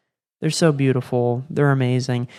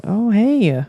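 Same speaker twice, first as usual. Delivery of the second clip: much higher, sing-song hinting question; sonogram tech voice; 7 semitones higher, 4 dB louder than usual. Retold or imitated. Sonogram tech hints at twins to the parents, high sing-song. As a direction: tease the parents with a knowing hint. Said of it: "I hear the sonogram tech go".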